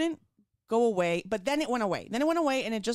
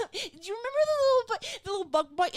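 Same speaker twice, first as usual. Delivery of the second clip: laughing, pitched way up, asking timidly; nervous laugh; 13 semitones higher, 4 dB louder than usual. Retold or imitated. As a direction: giggle nervously through the question. Nervous laughing question to doctor.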